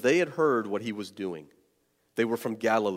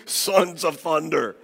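relishing the name; 3 semitones higher, slower than usual. Real, not acted. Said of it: "Are you kidding me?".

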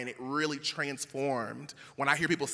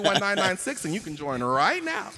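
mock announcement; 4 semitones higher, 8 dB louder than usual. Jokingly plugs the church's text number.

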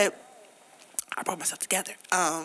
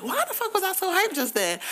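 baffled self; 11 semitones higher than usual. Retold or imitated. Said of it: "I was like".

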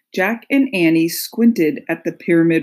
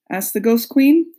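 plain reading voice

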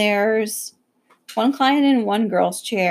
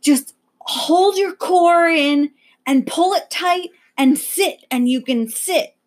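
coaching instructions; 6 semitones higher, slower than usual. Retold or imitated. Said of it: "she was telling the lady".

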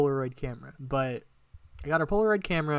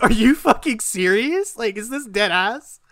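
much higher, louder, overwhelmed with joy; tearful disbelief; 8 semitones higher, 8 dB louder than usual. Retold or imitated. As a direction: burst out in teary disbelief. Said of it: "tears start falling from my face".

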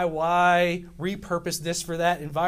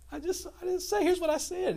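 put-on pleading kid; 11 semitones higher, 4 dB quieter than usual. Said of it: "And I'm like".

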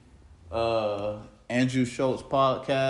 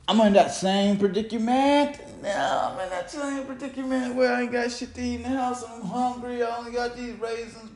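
whiny complaint; 9 semitones higher than usual. Real, not acted. Complaining about hunger, put-on voice.

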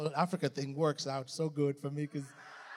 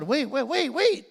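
higher, louder bewildered donkey; confused donkey; 9 semitones higher, 8 dB louder than usual. Pretend. Donkey back in the shed misses the crowd, higher bewildered cry. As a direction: play the lost donkey searching.

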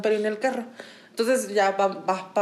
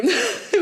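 high nervous giggle; nervous laugh burst; 12 semitones higher than usual. Real, not acted. Telling her friend the story, a high nervous giggle.

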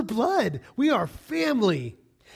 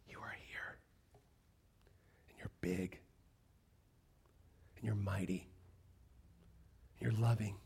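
very soft and low, long pauses; reverent whispered prayer; 9 semitones lower, 12 dB quieter than usual. Acted. Worshipper telling God who he is, awed whisper. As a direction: whisper each phrase in awe, letting it settle.

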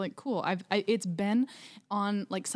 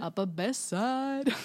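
drawn-out, higher teasing echo; mocking echo; 3 semitones higher, slower than usual. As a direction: parrot it slowly to tease your co-host. Mocking echo of co-host's phrase.

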